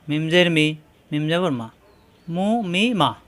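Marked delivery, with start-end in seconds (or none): none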